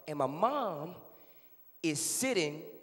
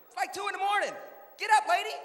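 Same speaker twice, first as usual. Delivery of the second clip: high-pitched telling-off; exasperated scolding; 3 dB louder, faster than usual. Acted. Child tells mom to get up, high and exasperated. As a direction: scold her in a strained high voice.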